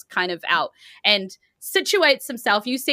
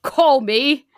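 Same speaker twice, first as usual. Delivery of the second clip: put-on higher, louder, chuckling old man; jolly Santa; 6 semitones higher, 6 dB louder than usual. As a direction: chuckle through it, pleased. GM voices Santa character.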